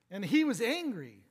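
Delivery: ordinary talk, nothing marked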